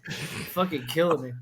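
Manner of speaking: ordinary talk, nothing marked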